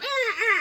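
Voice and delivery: put-on very high baby cry; mock newborn wail. Baby just born, high mock crying. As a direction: squeal like a newborn.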